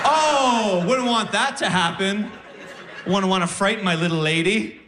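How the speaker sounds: high, mock-worried cooing; sarcastic concern. Comic scoffs at the fan's advice, high and mock-concerned.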